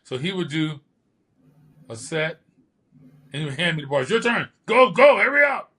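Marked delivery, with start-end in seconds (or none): 4.1-5.7 shouted high and loud, hurrying him; retold yelling. Coach yelling at him to hurry at the bars. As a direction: bark the orders like a drill coach. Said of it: "Yelling at me".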